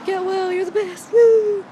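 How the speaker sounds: high, loud cheerful send-off ending in a whoop; loud, high, gushing woo; excited cheer. Retold or imitated. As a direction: cheer him on at the top of your voice. Reading her note to Austin.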